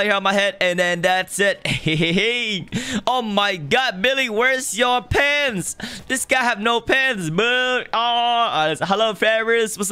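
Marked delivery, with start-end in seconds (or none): none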